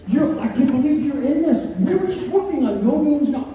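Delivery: high-pitched, flustered reproach; panicked scolding. Retold or imitated. Retold panicked scolding of the girl.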